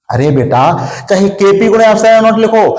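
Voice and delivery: usual speaking voice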